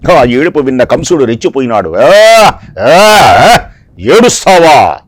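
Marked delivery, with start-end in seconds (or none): 2.0-5.0 louder snarl of rage at the crying sister; a furious king roars at his sister's crying; angry Kamsa. Acted. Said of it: "ఆగ్రహించాడు". (కంసుడు చెల్లెలి ఏడుపుపై కోపంగా గర్జిస్తూ)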